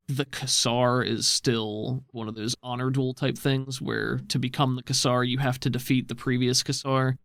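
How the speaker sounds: usual speaking voice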